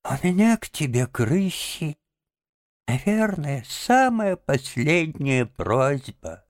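high, feeble deathbed voice; halting last-request plea, high and frail; ailing Toad, dying. A narrator's reading. Sick Toad asking Rat for a doctor, dying voice.

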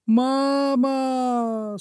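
high drawn-out cry for mama; frightened cry. Acted. Caught bunny calls his mother, a high frightened cry. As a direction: call for mama in pain. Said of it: "закричал зайка Петя".

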